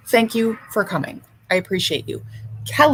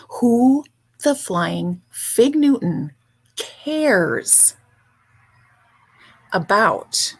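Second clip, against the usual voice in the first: ordinary talk, nothing marked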